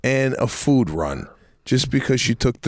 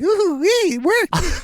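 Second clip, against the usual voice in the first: much higher, giddy squeal; much slower than usual. Retold or imitated. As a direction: squeal like a delighted kid. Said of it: "I'm, like, all giddy".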